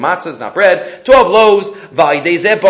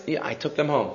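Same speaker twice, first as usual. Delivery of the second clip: very soft, low sheepish admission; acted embarrassed mumble; 5 semitones lower, 10 dB quieter than usual. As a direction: confess it shamefacedly under your voice. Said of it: "So embarrassed".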